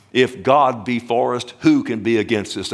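ordinary delivery

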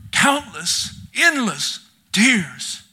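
high and slow, stretching endless out; a preacher's long grieving cry; drawn-out lament. Acted. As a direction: wail the words slowly.

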